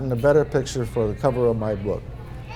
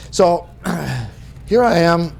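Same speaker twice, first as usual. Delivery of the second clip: usual speaking voice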